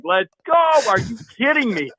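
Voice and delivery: high, loud elated yelling; screaming with joy. Retold or imitated. Hunter screaming to friends over the kill, ecstatic high shout. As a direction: shout it out like your buddy just dropped a buck. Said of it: "I am screaming as loud as I can".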